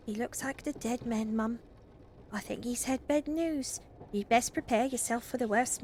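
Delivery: high, gossipy Scouse servant; Scouser maid. Acted. Maid Ruth brings bad news to Mother, high-pitched Scouse. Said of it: "maid always has, like, a Scouser accent".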